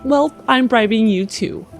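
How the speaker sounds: usual speaking voice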